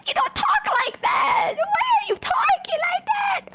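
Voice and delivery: very high squealed scolding; flustered shriek. Acted. Telling the friend off for sweet-talk, flustered shriek. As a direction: shriek it as if overwhelmed by their voice.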